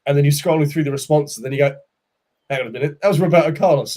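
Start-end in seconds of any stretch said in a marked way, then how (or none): 2.5-4.0 rising in disbelief, louder at the name; spotting something unbelievable; double-take at the name; surprised. Retold or imitated. Excited discovery while scrolling.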